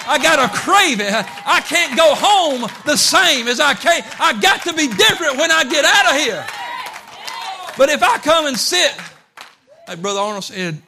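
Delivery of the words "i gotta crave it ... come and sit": shouted, far higher preaching; preaching at full force. Acted. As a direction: build into a fervent shout. Preaching climax.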